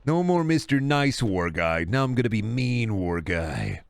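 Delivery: normal talking voice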